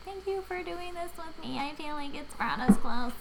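high-pitched tearful gratitude, hammed-up; mock tearful. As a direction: imitate a girl thanking through tears. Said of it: "she's, like, crying".

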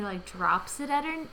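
usual speaking voice

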